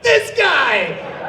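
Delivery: high, loud laughing exclamation; excited. Retold or imitated. Casting team reacting to the audition, laughing excitedly. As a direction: cheer like you just found your guy. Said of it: "they're like".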